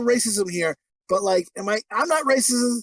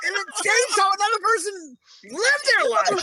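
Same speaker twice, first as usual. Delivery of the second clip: mock indignation; 9 semitones higher than usual. Mock outrage continues.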